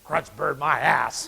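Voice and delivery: very slow, drawn-out put-on husband voice; grumpy husband. Husband scoffing at the bird from behind his paper, slow and grumpy. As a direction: drag the punchline out slowly and dismissively.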